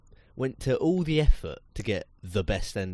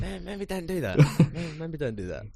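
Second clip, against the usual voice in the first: much higher, tactful mock suggestion; polite studio hint; 6 semitones higher than usual. Mimicking the lads hinting at another take. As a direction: gently suggest a retake. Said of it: "give you the".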